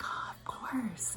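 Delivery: imitating a soft, high, drawn-out coo; tender agreement. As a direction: stretch it out slowly in an airy, hushed voice. Imitates singer agreeing to hug.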